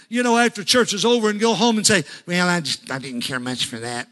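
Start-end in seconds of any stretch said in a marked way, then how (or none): 2.3-4.1 low, soft mock grumble of a sour member; lightly voiced sulky complainer; grumbling churchgoer. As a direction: sniff it like a disappointed pew-sitter.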